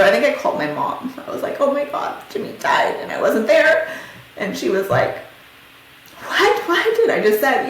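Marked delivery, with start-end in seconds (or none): 1.6-3.9 high-pitched, breaking bad news; retold distress. Daughter phoning mom about the death, distraught. 6.2-7.0 imitating a stunned, very high what; shocked disbelief. Mom hearing the news by phone, shocked. Mom's retold reaction to death.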